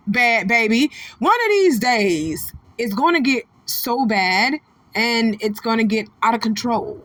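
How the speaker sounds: normal talking voice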